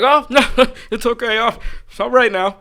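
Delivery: high, laughing nervous mimicry; mock his nervous backing off; nervous groundskeeper. Groundskeeper retreating, nervous high laugh. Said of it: "he was just like".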